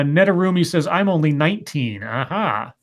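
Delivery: ordinary talk, nothing marked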